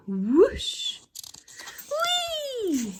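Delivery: put-on high loud whooshing whee as a thrilled boy; a high gleeful boy's whoosh and whee; exclaimed. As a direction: squeal it like a kid on a slide. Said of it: "he exclaimed".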